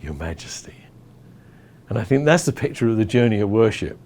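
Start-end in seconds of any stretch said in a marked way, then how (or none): 0.0-0.8 whispered, hushed and humble; a kneeling whisper before the throne; reverent whisper. Retold or imitated. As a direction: whisper it on your knees. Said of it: "you kneel and you say".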